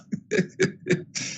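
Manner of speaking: light, breathy chuckle; amused chuckle. Real, not acted. Lecturer amused while directing a student on screen, soft chuckle. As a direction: chuckle softly to yourself.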